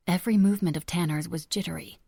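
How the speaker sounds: ordinary delivery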